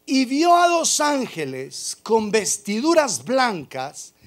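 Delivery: loud, slow, emphatic scripture reading; preaching. Acted. Pastor proclaims the angels at the tomb, booming. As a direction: proclaim each word to the congregation.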